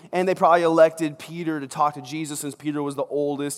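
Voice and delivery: normal talking voice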